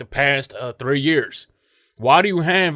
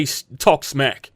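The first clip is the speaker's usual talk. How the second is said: ordinary delivery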